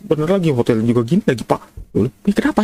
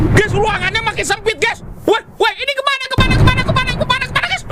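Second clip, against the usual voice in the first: high frantic yelling; panicked shrieking; 12 semitones higher than usual. Real, not acted. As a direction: shriek as the walls close in. Room shrinking, panicking.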